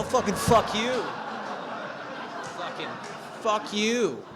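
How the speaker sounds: high, loud mock yell at the parent. Teen in treatment shouting at a parent across the room, mock yell.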